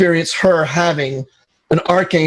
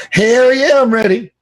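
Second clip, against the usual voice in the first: eager yes; 7 semitones higher than usual. Retold or imitated. Eager reply to the journey offer.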